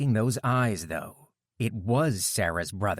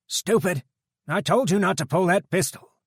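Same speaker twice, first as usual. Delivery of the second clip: biker deputy voice; 10 semitones higher, 6 dB louder than usual. A narrator's reading. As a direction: coolly call out the guard's threat. Dutch voiced.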